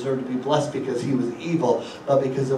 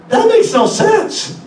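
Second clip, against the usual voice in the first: mock disbelief; 6 dB louder, slower than usual. Mock incredulity in sermon.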